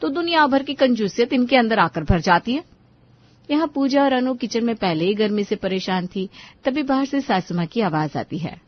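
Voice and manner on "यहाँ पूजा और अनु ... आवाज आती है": narrate, building to the call; story narration. A narrator's reading. Narrator voice between scenes.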